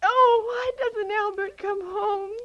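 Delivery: slow, crying, breaking into sobs; tearful plea. Acted. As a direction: weep it out, longing for him to come. Alone, waiting for her husband.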